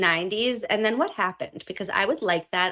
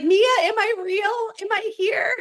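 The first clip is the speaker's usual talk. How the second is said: higher, dazed and lost; mock confusion; 11 semitones higher than usual. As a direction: play the lost one, pitched up. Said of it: "You'd be like".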